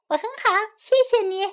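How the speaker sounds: high, quick chick voice; a tiny chick's speedy, polite reply; little chick. A narrator's reading. Pip answers in translation.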